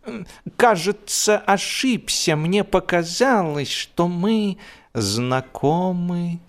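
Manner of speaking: high, flustered dog voice; a frightened dog apologising while backing away. A narrator's reading. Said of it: "отступая по Хай-стрит".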